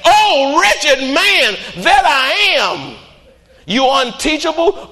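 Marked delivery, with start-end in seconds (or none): 0.0-3.0 high, very slow mournful wail; drawn-out lament. Acted. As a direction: stretch each word in grief. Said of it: "you ought to be saying".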